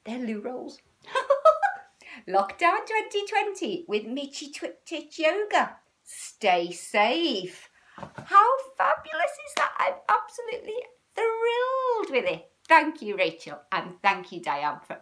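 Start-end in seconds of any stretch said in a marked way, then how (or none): 1.1-1.8 squealing laugh; high delighted laugh. Real, not acted. Laughing at the loo rolls on the t-shirt, squealing laugh. 2.3-14.4 high and loud, gushing; thrilled. Real, not acted. Thanking Rachel and Diane for the t-shirt, thrilled.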